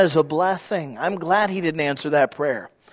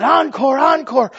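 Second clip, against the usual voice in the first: high, retold crowd cheer calling for more; crowd shouting encore; 8 semitones higher, 3 dB louder than usual. Crowd begging the violinist for more, a high mock cheer. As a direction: call it out like a whole crowd on its feet. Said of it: "they shouted".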